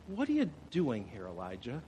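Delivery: a hushed, gentle divine question; God's quiet question. Acted. God softly asks Elijah why he is there, hushed. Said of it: "The Lord said to him".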